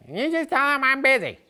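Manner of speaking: high-pitched father-in-law. Retold or imitated. Father-in-law brushing him off from the background, mocked in a squeaky high voice. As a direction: mimic him squeaking from the back of the room. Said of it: "he's got the really high-pitched voice".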